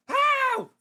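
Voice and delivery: high loud disbelief; shocked how. Acted. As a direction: gasp it out.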